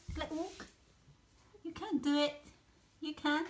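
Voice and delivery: high, sing-song coaxing of the puppies; pet talk. Real, not acted. Cooing and calling the puppies.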